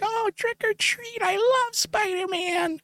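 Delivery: put-on very high, slow, breathy-sounding tiny kid; small boy gushing at Spider-Man; little kid voice. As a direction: coo it like a starstruck toddler.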